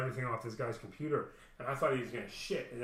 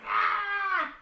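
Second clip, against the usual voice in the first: sharp high mock shriek, much higher; imitated scream; 7 dB louder than usual. Partner shrieking after being pushed onto the pillow, imitated scream. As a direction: yelp it like she's freaking out. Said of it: "she started freaking".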